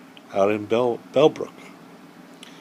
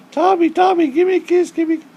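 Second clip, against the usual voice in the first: put-on very high childish sing-song; kids begging; 3 dB louder, faster than usual. Neighbor kids begging the turtle for a kiss, childish sing-song. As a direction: plead like eager little kids. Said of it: "they were like".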